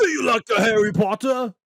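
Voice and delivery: put-on British accent teasing like a wizard fan; British accent playing a Harry Potter fan. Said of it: "I was thinking Harry Potter".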